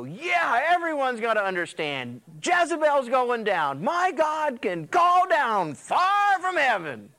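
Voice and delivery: high, loud, slow victory crow; Elijah's hammed-up boast; triumphant gloating. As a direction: crow over the queen like a champion. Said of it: "you think Elijah's gotta go".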